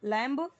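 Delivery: लैम्ब with a full ब at the end; wrong B-sounded लैम्ब. Shown as a demonstration. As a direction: pronounce the silent b in लैम्ब on purpose. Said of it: "ऐसे पूरा ब नहीं बोलना है".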